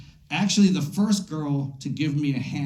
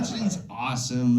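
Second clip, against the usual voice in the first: normal talking voice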